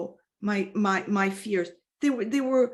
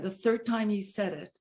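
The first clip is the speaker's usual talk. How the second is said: ordinary delivery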